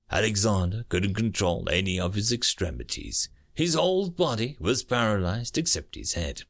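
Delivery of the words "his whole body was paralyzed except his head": ordinary delivery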